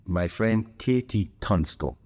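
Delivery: normal talking voice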